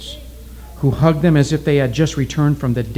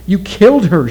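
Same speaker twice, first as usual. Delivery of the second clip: higher, louder accusing shout; 6 semitones higher, 6 dB louder than usual. A narrator's reading. Oki shouting at the witch doctor, higher and louder. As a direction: hurl the accusation. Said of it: "shouted Oki".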